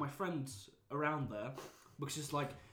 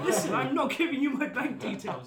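loud, high-pitched comic refusal; raised voice, mock exasperation; retold indignant refusal; 9 semitones higher, 8 dB louder than usual. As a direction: refuse with hammed-up outrage. Retells refusing bank details.

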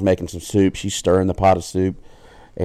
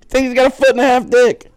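laughing punchline; 4 dB louder than usual. Real, not acted.

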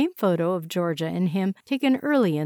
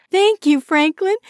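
excited thanks; 10 semitones higher, 8 dB louder than usual. Acted. Grateful, rushing out the door.

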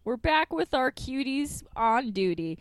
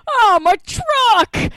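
high, loud, drawn-out wail; anguished cry; 13 semitones higher, 11 dB louder, much slower than usual. Retold or imitated. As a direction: wail it slowly at the top of your voice. Bread truck stolen, owner cries out.

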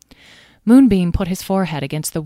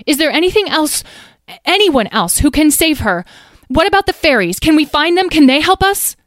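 much higher, louder, frantic questions; panicked; 11 semitones higher, 9 dB louder than usual. Acted. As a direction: grasp at any idea in panic.

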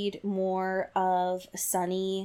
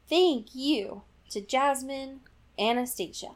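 ordinary delivery

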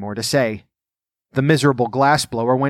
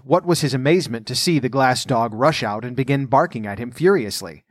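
ordinary delivery

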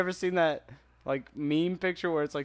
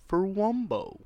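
Wumbo said slow and higher, leaned on; stress on Wumbo; 5 semitones higher, 3 dB louder, slower than usual. Retold or imitated. Quoting SpongeBob to friend, Wumbo stressed high. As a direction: land hard on Wumbo.

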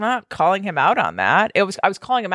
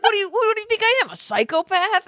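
mock incredulous husband; 10 semitones higher than usual. Imitating husband defending his order.

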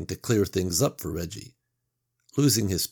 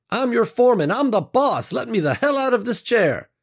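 high, loud bellowing boss; furious tied-up boss; 7 dB louder than usual. Acted. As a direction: yell it from the chair, outraged. Tied-up boss yells at workers.

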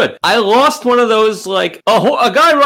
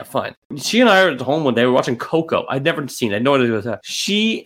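ordinary talk, nothing marked

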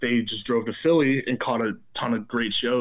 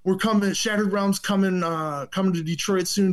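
higher-pitched, menacing retold threat; Joe Hardcore; 6 semitones higher than usual. Joe Hardcore warns the teenager off the shows, threatening. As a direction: imitate a tough caller issuing a warning. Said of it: "And he's like".

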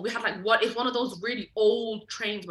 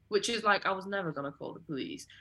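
ordinary talk

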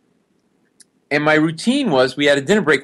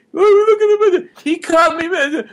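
very high, shrill wail, hammed-up; hysterical girlfriend; 4 dB louder, slower than usual. Girlfriend wailing over her cut boyfriend, mock high hysteria. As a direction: play the panicking girlfriend, pitched way up. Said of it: "His girlfriend's such a".

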